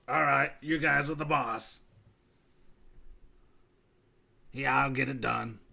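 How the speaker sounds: low rough ghoul rasp. Acted. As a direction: growl it like a ghoul contractor. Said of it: "that ghoul voice is rough to try to do that".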